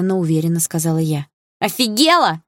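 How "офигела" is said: high, outraged exclamation; bully's raised-pitch outburst; indignant yell. A narrator's reading. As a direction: yell it in disbelief. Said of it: "заорала высокая девица".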